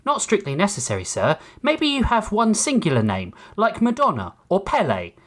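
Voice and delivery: politely condescending synthetic servant; calm robot butler. A narrator's reading. Ship's robot telling passenger he is missing from manifest, calm and polite.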